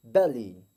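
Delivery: बेली with the y sound contracted. Shown as a demonstration. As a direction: clip the y in बेली short. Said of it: "वाई को हम थोड़ा contract होकर बोलेंगे".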